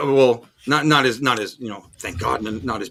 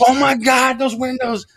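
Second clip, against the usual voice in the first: panicked; 10 semitones higher than usual. Retold or imitated. Panicked diners during the earthquake.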